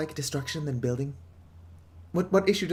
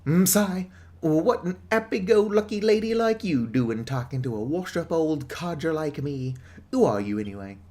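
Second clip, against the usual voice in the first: put-on a washed-up old Brit, laying on the accent; British accent; 3 semitones higher, 4 dB louder, slower than usual. Old pub codger quizzing the newcomer, in a British accent. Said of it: "Just way too British".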